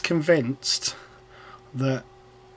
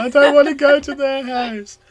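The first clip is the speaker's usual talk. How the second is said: high, louder tearful protest; imitating crying child; 11 semitones higher, 6 dB louder than usual. Mother retelling daughter's tearful refusal, high mock crying. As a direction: mimic her wailing that she won't go. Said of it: "And then she started crying".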